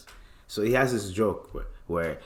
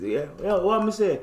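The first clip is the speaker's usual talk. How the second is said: higher mock Jamaican voice, asking back; Jamaican accent; 8 semitones higher than usual. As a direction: Mark calling back in patois.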